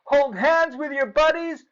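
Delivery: high, loud excited shouting; yelling. A narrator's reading. Clark shouts the remembered rules to Joey. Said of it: "Clark yelled".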